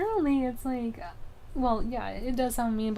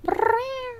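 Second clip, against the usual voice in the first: high rolled purring trill; playful cat trill, rolled; 13 semitones higher, 4 dB louder than usual. Retold or imitated. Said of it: "when I said".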